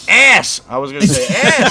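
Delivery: loud, high-pitched punchline yell; blurted shout. Retold or imitated. Host interrupting a nice moment with a crude joke, blurted shout. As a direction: belt out a one-word joke.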